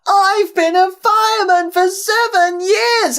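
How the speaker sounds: very high, loud, drawn-out yell; slow, piercing shout; calling up urgently. A narrator's reading. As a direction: holler it up to the driver in alarm. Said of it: "called up to Ben".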